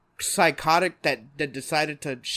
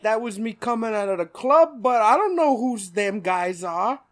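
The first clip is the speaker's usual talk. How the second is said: put-on raised thick gangster accent; Penguin; 5 semitones higher, 5 dB louder than usual. Penguin denying it to Batman, thick accent. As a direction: plead innocence in a hammed-up mobster accent. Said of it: "thick fucking accent".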